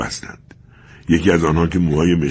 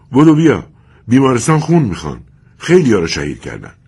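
loud, higher urgent summons; urgent call; 7 semitones higher, 6 dB louder than usual. Acted. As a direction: hurry him along, loud and urgent. Father urgently calling son.

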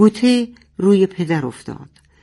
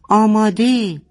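higher, louder shout of ready; 7 semitones higher, 4 dB louder than usual. Acted. Father shouting to Boteh that he is ready, loud. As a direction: call it out like a signal. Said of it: "پدر فریاد کشید".

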